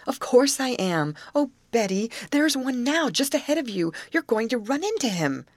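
high-pitched, urgent acted warning; alarmed warning. A narrator's reading. Grace warns the friend steering of an alligator ahead, alarmed.

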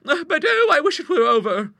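very high, loud fretful spinster; anxious old lady voice. Acted. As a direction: dread the visit like a nervous old lady. Nervous sister agrees, dreading visit.

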